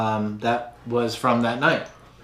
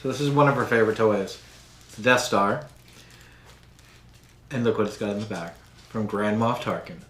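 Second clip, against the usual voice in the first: usual speaking voice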